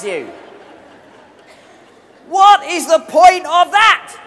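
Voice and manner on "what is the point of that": incredulous laughing shout. Real, not acted. Mocking nervous flyers, crowd laughing.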